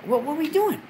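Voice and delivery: high flustered wait wait; panicked stop. Retold or imitated. Stopping the girl's advance, high and flustered. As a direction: backpedal in a rush. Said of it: "I'm like".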